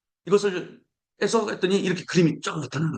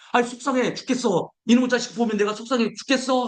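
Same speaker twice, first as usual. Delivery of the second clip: fed-up parent; 6 semitones higher than usual. Retold or imitated. Preacher voices a fed-up parent.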